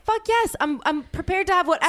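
high, excited agreement; worked-up willingness, pitched high; emphatic eager yes. Retold or imitated. Acting out their emphatic agreement.

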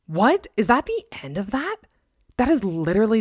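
loud, high disbelief; stunned by a creepy ending; shocked. Real, not acted.

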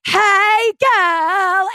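high, drawn-out, camp greeting; showy sing-out hello; flamboyant greeting. Retold or imitated. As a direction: imitate a flamboyant guy, stretching each word high. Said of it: "flamboyant beyond".

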